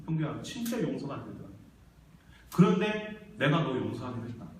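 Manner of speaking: low, soft, sorrowful confiding; gentle divine father. Acted. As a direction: speak tenderly, weary from pain. God confides gently after the rage.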